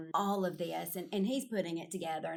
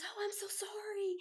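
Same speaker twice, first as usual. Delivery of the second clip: apologetic whisper; 13 semitones higher than usual. Retold or imitated. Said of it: "she goes".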